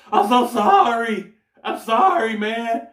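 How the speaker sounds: slow, loud, high sorry; loud pleading apology. Retold or imitated. Apologizing loudly to the drenched fisherman. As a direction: beg forgiveness, loud and drawn out.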